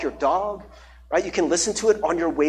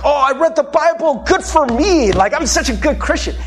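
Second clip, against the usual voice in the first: higher, hammed-up self-satisfied voice; mock self-congratulation; 8 semitones higher, 4 dB louder than usual. Preacher mimics checking off Bible reading, mock self-congratulation. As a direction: play at patting yourself on the back.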